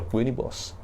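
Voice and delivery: ordinary talk, nothing marked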